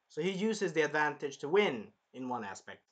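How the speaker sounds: ordinary talk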